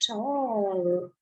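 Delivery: usual speaking voice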